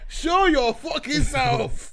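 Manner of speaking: loud, slow laughing jeer, pitched high; laughing taunt. Real, not acted. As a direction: drag it out while cracking up. Mocking friend, laughing loudly.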